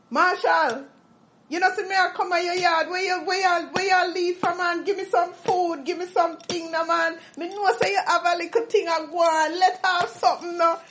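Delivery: high, fast pleading Jamaican patois; Jamaican patois beggar. Retold or imitated. Begging a returning islander for food in high patois. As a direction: beg for food in thick patois. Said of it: "some people would like".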